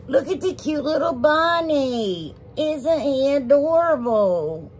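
high, slow cooing; gushing cute-voice. Pretend. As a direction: coo over something adorable, stretching the words out. Cooing over a cute bunny.

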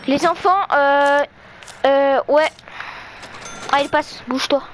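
normal talking voice

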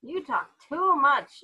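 a slow, loud scolding from father; yelled. Acted. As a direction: yell it slowly at the dog. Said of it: "yelled Father".

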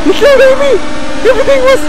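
high, wailing outburst; horrified shriek. Acted. Player mourning Amy, high horrified shriek. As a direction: cry out in hammed-up anguish. Reacts to Amy's death.

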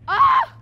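high, loud scream on the hit; tennis grunt. Real, not acted. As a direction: yell with the swing. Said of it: "I scream every time I".

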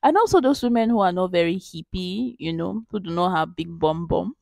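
ordinary delivery

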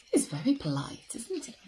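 unvoiced whispered aside. Real, not acted. Narrator whispers an aside to the children.

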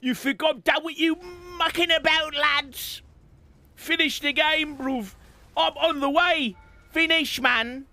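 put-on British accent. Taunting enemies, British slang.

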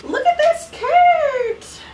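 sweet high voice calling the cat; pet talk. Real, not acted. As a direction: coo at the cat to say hello. Cooing at cat.

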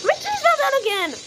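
frustrated whine. Real, not acted. Cheese keeps falling in game.

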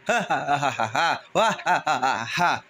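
staged staccato evil laugh; villain laugh. Pretend. Villain greets his cornered prey, mock evil laugh. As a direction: put on a bad guy's cackle. Villain appears in dinosaur play.

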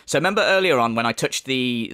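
ordinary talk

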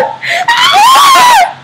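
high, loud squealing laughter; shrieking laugh. Real, not acted. Girl squealing with laughter at the camera effect.

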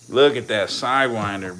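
usual speaking voice

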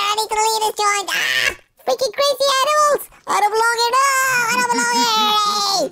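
high, pleading squeaky dog voice; panicked dog voice. Acted. Trapped puppy begging the pet shop to let him out, panicked and high. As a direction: beg to be let out like a trapped pup.

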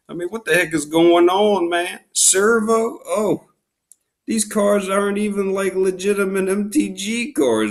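high-pitched, incredulous rant; exasperated. Real, not acted. Card opener ranting at the seller over suspect cards, exasperated. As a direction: protest in disbelief, voice pitched well up.

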